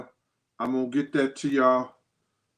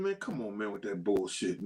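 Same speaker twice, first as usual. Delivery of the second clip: dismissive brush-off; 6 semitones lower, 10 dB quieter than usual. Retold or imitated. Quoting Gene's brush-off.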